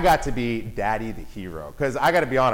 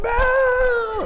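very high, loud drawn-out shout; little boy shout; 4 dB louder than usual. Retold or imitated. Son imitated shouting for Belle, very high and loud. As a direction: yell across the parade like a boy. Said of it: "Jackson's beside me going".

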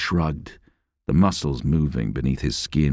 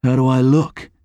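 anxious, pitched up and louder; concerned question; 8 semitones higher, 5 dB louder than usual. A narrator's reading. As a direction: ask it worried about his looks.

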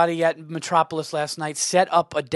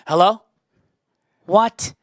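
louder pretend phone answer, rising; fake phone call; 4 dB louder, slower than usual. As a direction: play at taking a baffling call. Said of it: "That's how I said".

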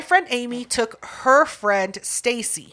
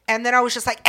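ordinary delivery

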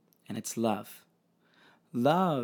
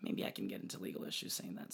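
whispered aside; 5 semitones lower, 11 dB quieter, faster than usual. Real, not acted. Worried aside about legal issues.

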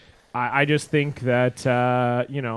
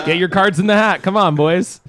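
raised, loud mock rallying; urging teammate; 5 semitones higher, 7 dB louder than usual. Imagined teammate at team dinner, loudly urging the others on. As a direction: egg the table on loudly.

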